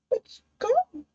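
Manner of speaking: ordinary talk, nothing marked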